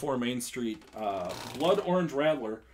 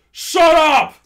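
full-force high shout; yelled; 17 dB louder than usual. Retold or imitated. Host recalling first meeting Colin across the street, yelled. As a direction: yell it sharply across the street. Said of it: "and I just yelled".

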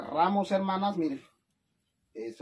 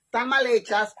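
loud; 4 semitones higher, 9 dB louder than usual. Real, not acted. Loud show-off of her gorditas.

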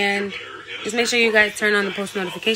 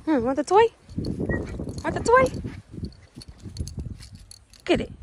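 high, rising playful question; coaxing a dog; 10 semitones higher than usual. Real, not acted. Offering the dog a toy, high playful coaxing. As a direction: pitch it up to tempt a pet.

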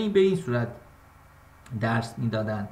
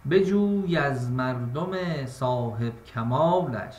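slow, measured verse reading; poetic recitation; 4 semitones lower, much slower than usual. Shown as a demonstration. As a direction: draw out each line like classical poetry.

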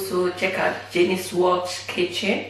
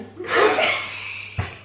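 breathy laugh; 11 semitones higher than usual. Real, not acted. Laughing at poo joke.

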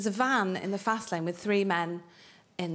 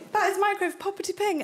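higher, chirpy poppity ping; higher, bouncy poppity ping; mock Welsh word; 10 semitones higher than usual. Shown as a demonstration. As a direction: sing out poppity ping brightly. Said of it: "what microwave is in Welsh".

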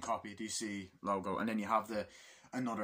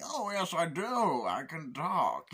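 higher, then slow; villain savouring his words; mock Bane voice, slow ending; imitating Bane; 6 semitones higher, slower than usual. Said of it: "It's like".

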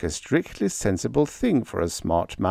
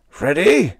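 higher, loud bellowed name; 8 semitones higher, 6 dB louder than usual. A narrator's reading. Leo bellowing at Freddie, enraged. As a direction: bellow his name across the room. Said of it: "he bellowed".

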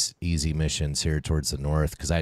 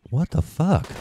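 incredulous outburst; 9 semitones higher, 6 dB louder than usual. Real, not acted. Reacting to friend's message.

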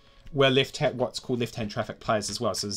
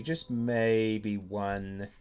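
drawn out; much slower than usual. Real, not acted. Musing over building placement.

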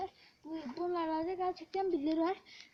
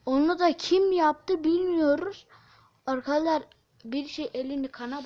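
loud; 13 dB louder than usual. Real, not acted. Loud spooky reveal.

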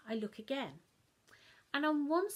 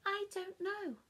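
higher feigned innocence; innocent child; 10 semitones higher than usual. Acted. Child to Mum, higher, innocent. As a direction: play dumb.

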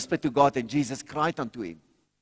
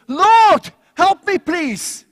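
shouted plea, much higher and louder, help me drawn out slowly; loud cry for help; 13 dB louder, much slower than usual. Shown as a demonstration. Preacher showing how he calls on God when urgent, loud shouted plea. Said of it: "Well, I do it quite loud".

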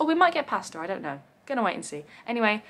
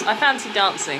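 ordinary delivery

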